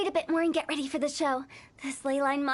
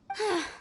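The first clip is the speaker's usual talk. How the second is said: weary sigh; 5 semitones higher than usual. Real, not acted. Weary sigh over stubborn folk.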